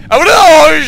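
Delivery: loud, high cry of dismay; dismayed wail. Real, not acted. Player reacting to a mishap, loud dismay.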